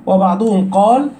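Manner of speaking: ordinary delivery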